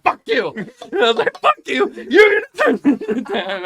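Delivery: full-throated scream at the neighbor; furious shout. Retold or imitated. Yelling at the juice bar lady, furious shout. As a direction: yell it with everything you have. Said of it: "I fucking started losing my fucking shit".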